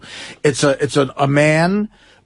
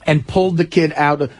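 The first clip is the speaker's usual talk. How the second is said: ordinary talk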